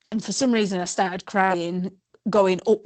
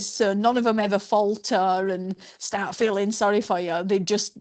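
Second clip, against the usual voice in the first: normal talking voice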